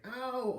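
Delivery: high, drawn-out ow; whiny ow. Acted. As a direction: whimper like a drama queen. Girl hopping in pain.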